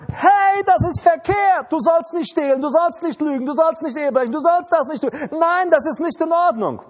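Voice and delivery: high, loud protesting shout that eases slightly; outcry. Retold or imitated. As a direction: cry out in alarm against the wrong. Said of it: "Du machst einen Aufschrei". (lauter, hoher empörter Aufschrei)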